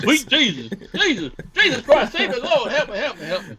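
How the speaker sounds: high, pleading preacher parody; mock pulpit preacher. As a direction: beg the Lord like a hammed-up preacher. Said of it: "back to the pulpit".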